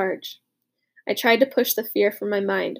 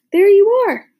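triumphant; 9 semitones higher, 8 dB louder than usual. A narrator's reading. Mifflin triumphant reply.

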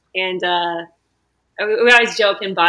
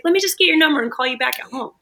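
quick, higher telemarketer patter; mock sales-pitch voice; salesman quote; 7 semitones higher, faster than usual. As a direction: rattle it off like a pushy salesman. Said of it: "he's like".

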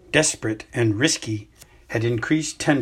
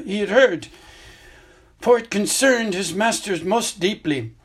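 put-on higher, louder elderly wizard voice, calm and grave; an old headmaster explaining gently but seriously; Dumbledore; 8 semitones higher, 5 dB louder than usual. Headmaster tells student about the hidden cave, grave and measured.

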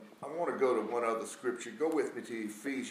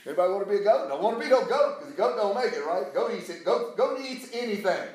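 loud, high preacher's delivery; full-force sermon, voice raised; fired-up preaching; 11 semitones higher, 11 dB louder than usual. Real, not acted. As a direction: hammer it home to the congregation.

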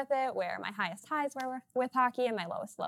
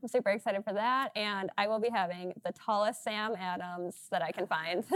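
ordinary talk

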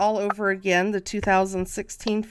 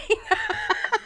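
high giggle; 13 semitones higher than usual. Real, not acted. Host tongue-tied, laughs.

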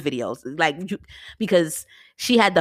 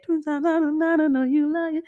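mock fan reaction; 10 semitones higher than usual. Voicing fans reacting in audience.

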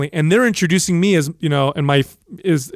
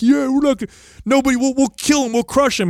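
mocking cocky relatives; 9 semitones higher than usual. Mocking cocky Sicilian men.

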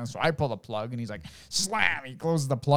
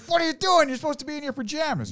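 exasperated yell; 13 semitones higher than usual. Retold or imitated. Father scolding son.

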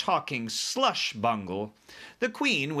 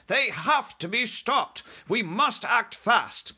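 high, emphatic royal alarm; Queen, urgent; 11 semitones higher, 4 dB louder than usual. Acted. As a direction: press the urgency as an indignant Queen.

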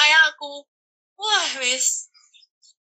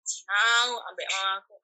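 ordinary talk

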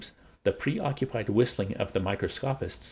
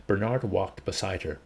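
ordinary delivery

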